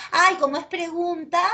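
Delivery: lightly mocked student, pitched high; imitating naive students. Teacher mimics students who assume questions take accents, mildly mocking. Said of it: "hay algunos que dicen".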